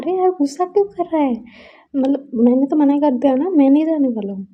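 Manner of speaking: exasperated pleading. Retold or imitated. Friend pleads with jealous friend.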